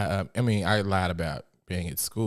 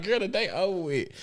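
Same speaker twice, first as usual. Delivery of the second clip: sassy; 11 semitones higher than usual. Retold or imitated. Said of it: "I was like".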